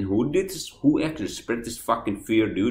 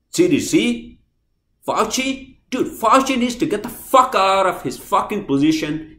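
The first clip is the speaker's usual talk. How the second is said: worked-up, louder ranting with raised pitch; angry rant; 5 dB louder than usual. Real, not acted. Vlogger railing against booster rules and Fauci, angry rant. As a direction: rail at them, punching the swear words. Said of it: "that's my fucking rant".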